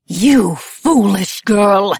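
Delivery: loud, drawn-out scolding; Madame Labelle, furious. A narrator's reading. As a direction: stretch each word in loud fury. Furious scolding, fists clenched.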